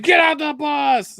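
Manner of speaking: high, drawn-out mock shout; imitating yelling father. Driver acting an abusive father yelling through a door, mock shout. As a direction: holler it as the angry dad. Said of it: "You want me to yell at the kid?".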